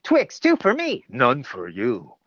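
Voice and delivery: playful ad tease; Twix ad voice again. Retold or imitated. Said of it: "she heard".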